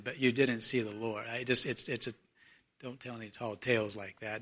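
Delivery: low, soft, patronizing reassurance; placating disbelief. Acted. Disciples humoring Mary after her report, placating disbelief. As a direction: talk her down quietly and haltingly.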